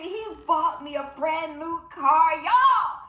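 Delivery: high, loud, thrilled announcement; bursting with joy over the new car; excited outburst. Real, not acted. Joyful news of a gifted car.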